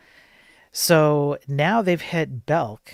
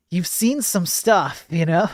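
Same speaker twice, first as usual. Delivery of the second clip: real laugh breaking through, slower and higher; amused, laughing repeat of the joke; 4 semitones higher, 5 dB louder than usual. Real, not acted. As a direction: chuckle your way through the repeat.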